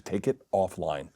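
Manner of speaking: low, quiet, deliberate "Take it ... offline"; insistent repeat. Retold or imitated. Repeats it after his "What?.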